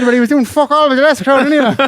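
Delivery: mock-serious punchline; animated quip. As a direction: rattle it off with a grin. Retold quip to stingy man.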